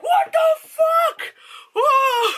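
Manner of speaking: very high, loud, slow startled shout; shocked outburst. Retold or imitated. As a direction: jump out of your seat in shock. Audience jump scare reaction.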